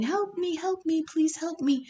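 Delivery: high, pleading cry of a small snake; pleading for help. Retold or imitated. Little snake caught by a vulture begs the hunter, high and pleading.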